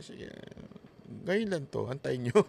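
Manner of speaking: very soft muttered warning under the breath; quiet threat. Retold or imitated. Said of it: "napapaganyan lang siya". (mahinang bulong na banta)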